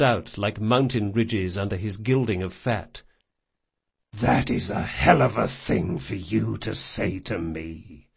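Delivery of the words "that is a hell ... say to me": a low, flat, deadly hiss; cold fury held down hard. Acted. Jean, furious at being told to leave, hisses flat and deadly. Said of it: "hissed in the flattest, deadliest voice".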